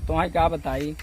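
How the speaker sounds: ordinary delivery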